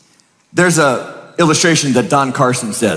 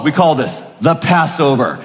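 ordinary talk, nothing marked

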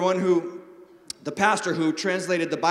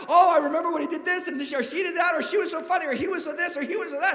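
much higher, quick, laughing reminiscence; mock funeral eulogy; 8 semitones higher, faster than usual. Preacher mimicking mourners reminiscing, high and laughing. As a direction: gush like a mourner telling stories. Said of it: "they talk".

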